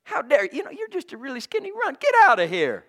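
a hint of a scornful brother, high and rushed; scornful older brother. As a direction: belittle the kid brother. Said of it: "His own brother said".

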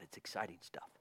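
whispered, very soft remark; hushed aside. Real, not acted. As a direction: drop to a whisper. Aside after urging Bible reading.

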